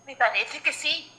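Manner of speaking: ordinary talk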